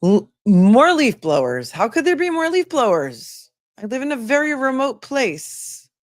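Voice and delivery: high, swooping, incredulous complaint; exasperated disbelief. Real, not acted. Streamer hearing leaf blowers at a remote home, exasperated. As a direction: wail it in real exasperation, stretching the words.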